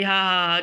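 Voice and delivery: amused motherly laughter; mother's laugh. Acted. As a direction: laugh out loud. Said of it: "माँ हंसते हंसते कह रही".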